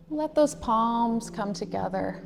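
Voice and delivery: normal talking voice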